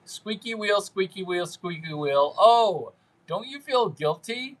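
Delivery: ordinary talk, nothing marked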